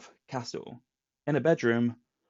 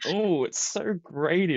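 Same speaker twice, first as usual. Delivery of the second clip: stretched, higher-pitched teasing echo; mock repeating; 6 semitones higher, slower than usual. Teasing a co-host for repeating it on air, high drawn-out imitation. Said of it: "But you started repeating".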